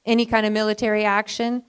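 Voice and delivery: ordinary delivery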